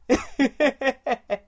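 high mocking cackle; evil chuckle. Wolf man mocks the shamed woman, evil chuckle. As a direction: laugh down at her.

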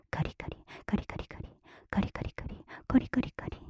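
rapid whispered "カリカリ" in short bursts; quickened repetition. Acted. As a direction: run the soft "カリ" sounds together quickly. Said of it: "ペースが速くなる".

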